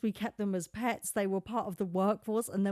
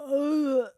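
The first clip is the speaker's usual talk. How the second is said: loud, higher disgusted ugh; mock groan; 7 semitones higher, 7 dB louder than usual. Nino groaning at women and kids, loud mock ugh. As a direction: groan loudly at the beach crowd. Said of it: "and be like".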